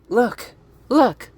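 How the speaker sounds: high-pitched voice. Pretend. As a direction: push the pitch up each time. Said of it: "that high-pitched voice".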